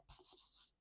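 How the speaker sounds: soft voiceless ps ps like calling a cat. Shown as a demonstration. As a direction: whisper ps ps to a cat. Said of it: "almost like you're calling a cat".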